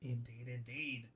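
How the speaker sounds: put-on pompous old announcer agreeing; mock-grave deep voice.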